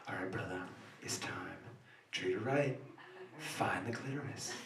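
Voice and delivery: low, soft, seductive coaching voice. Retold or imitated. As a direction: whisper it deep like a soul singer. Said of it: "what I wish I sounded like when I whisper".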